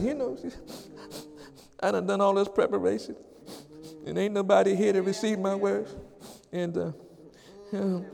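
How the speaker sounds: soft, halting, defeated mumbling; discouraged mumble. Retold or imitated. Preacher imitating his discouraged inner voice, soft and halting. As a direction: mumble it like you've given up. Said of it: "I could have said".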